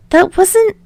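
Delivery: rambling. Acted. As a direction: babble on nervously without a breath.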